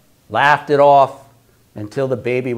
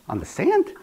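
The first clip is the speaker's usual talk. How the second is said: incredulous; 10 semitones higher, 4 dB quieter than usual. Retold or imitated. Noah's incredulous question.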